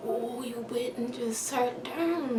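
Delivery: slow, whispered disbelief; whispered slow reproach; hurt whisper. Retold or imitated. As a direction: breathe it out, wounded. Hurt aside to cousin.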